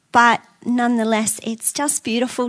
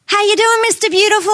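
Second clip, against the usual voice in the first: calling out greeting; 6 semitones higher than usual. Retold or imitated. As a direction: imitate locals hailing a friend, higher pitched. Retold greetings called across the market.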